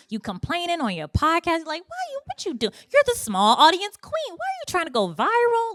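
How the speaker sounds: berating herself for chasing viral Reels, mocking and high; inner critic. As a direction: sneer at yourself like a harsh inner critic. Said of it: "That's how I felt with Reels".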